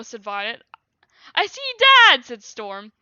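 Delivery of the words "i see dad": much higher, loud thrilled cry; excited shout. Acted. Storm spotting his dad from the sky, thrilled shout. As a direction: shout it with delight.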